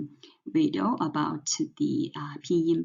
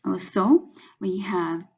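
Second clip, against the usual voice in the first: ordinary talk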